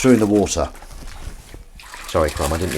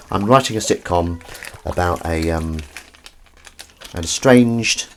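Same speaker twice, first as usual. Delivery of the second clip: ordinary delivery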